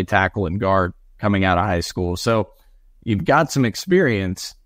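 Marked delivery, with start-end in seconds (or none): none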